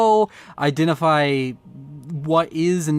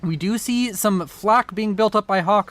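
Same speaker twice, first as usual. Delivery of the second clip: ordinary talk, nothing marked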